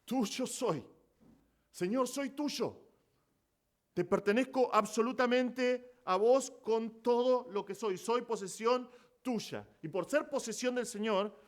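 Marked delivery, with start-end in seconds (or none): 0.0-9.7 slow, high, fervent surrender to the Lord; fervent exclamation. Retold or imitated. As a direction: draw it out like a heartfelt vow. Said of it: "Fíjense la exclamación que él hace".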